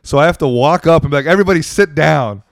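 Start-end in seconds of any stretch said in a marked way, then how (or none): 1.3-2.4 loud retold crowd command; calling out. Comic making the dancing crowd sit, calling out loudly. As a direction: imitate calling a room to order.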